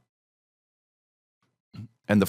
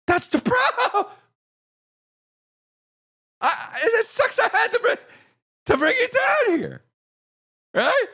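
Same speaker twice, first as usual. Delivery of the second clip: very high, laughing, half-shouted reply; laughing exasperation. Retold or imitated.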